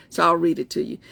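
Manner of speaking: normal talking voice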